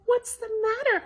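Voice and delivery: high concerned mother; mother's voice. Acted. Mother asking crying Betty Bunny, high. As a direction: ask gently. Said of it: "her mother asked".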